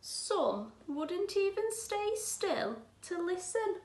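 put-on gentle, clear read-aloud for children; teacher reading a picture book aloud; storybook reading. A narrator's reading. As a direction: read it like a bedtime story to the class.

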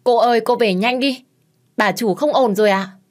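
loud, high urgent yell; shouting. A narrator's reading. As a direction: call her home in a panic. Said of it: "Tiếng của con Hoài hét lớn".

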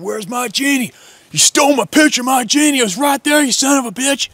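put-on high, furious drunken-bully yell; sneering yell. Bully leaning over an old gentleman, sneering yell. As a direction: scream it at him, full force. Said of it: "sneering and yelling at him".

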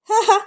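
short eerie taunting chuckle; ghostly mocking laugh. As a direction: laugh at him like a spirit. (கேலியான பேய்ச் சிரிப்பு)

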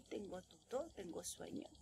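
soft drowsy voice, fading to a breath; sleepy murmur trailing off; sleepy mumble. Shown as a demonstration. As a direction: sound half asleep. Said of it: "I'm sleepy".

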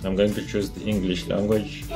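usual speaking voice

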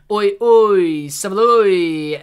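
loud, slow Cockney "Oi, oi, Savaloy"; hammed-up Cockney hello; Cockney greeting. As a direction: bellow it like a London street greeting. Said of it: "as the Conseil say in London".

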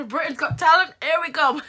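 loud, high cheer with a laugh; mock triumphant. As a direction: announce it like a winner.

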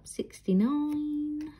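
slow, high drawn-out bingo call; bingo caller. Retold or imitated. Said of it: "from the bingo lingo".

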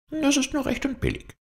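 very high, thin fox voice; high-pitched sickly fox; feeble fox. A narrator's reading. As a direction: play the poorly fox in falsetto. Fox feigning injury to wolf.

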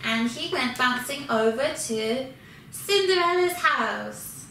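plain reading voice

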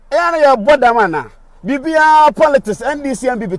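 loud, high, indignant outburst; furious shout at a viewer who told him to talk to the president; angry shout. Real, not acted. Angry retort to an online commenter.

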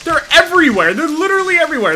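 loud, high, hurried alarm; alarmed outburst. Real, not acted. Player followed by cannibals everywhere, loud alarm. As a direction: raise the alarm urgently.

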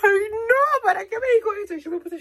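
a very high, loud comic wail of hurt; mock wounded wail. Vlogger hamming up her hurt after being told she is not a woman. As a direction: wail it like a wounded drama queen.

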